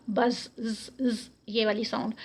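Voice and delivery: soft, held buzzing ز sound. Shown as a demonstration. Teacher shows the buzzing sound of زا. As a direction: let the ز buzz. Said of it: "زا میں buzzing sound آتی ہے".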